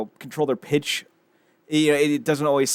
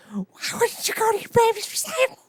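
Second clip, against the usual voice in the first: very high, squeaky fake crying; mock tearful wail. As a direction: wail it through hammed-up tears. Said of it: "the tears".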